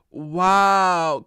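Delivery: ordinary talk, nothing marked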